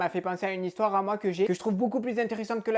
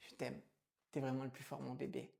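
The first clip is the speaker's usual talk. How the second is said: much softer and lower, doting; tender whisper; 10 semitones lower, 19 dB quieter than usual. Acted. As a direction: breathe it lovingly to him. Mère chuchote tendrement.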